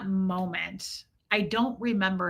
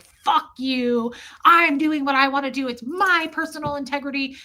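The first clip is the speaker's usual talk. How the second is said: mock tantrum, higher and louder with punched words; retold toddler-like flip-out; 5 semitones higher, 5 dB louder than usual. Doug defying his church over his integrity, mock raised-voice tantrum. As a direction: imitate a hard head freaking out. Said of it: "He would just flip out and be like".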